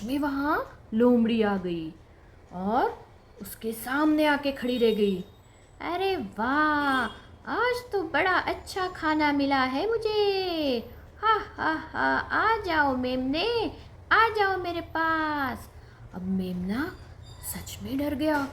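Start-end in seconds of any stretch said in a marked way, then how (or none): 5.8-15.7 slow, high gloating fox voice; sly fox. Acted. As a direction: savour each word like a hungry fox. Fox gloats over her meal. (धीमी, ललचाती लोमड़ी की आवाज़)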